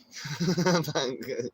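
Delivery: real high giggling laugh; cracking up. Real, not acted. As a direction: burst out laughing at your own joke. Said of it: "I was cracking up".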